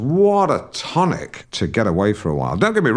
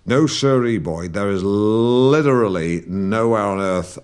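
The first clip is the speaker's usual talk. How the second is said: ordinary delivery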